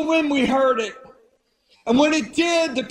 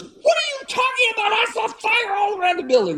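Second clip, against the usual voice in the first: excited insistence; 10 semitones higher than usual. Retold or imitated. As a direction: burst out at him in disbelief. Singer insists she saw fire.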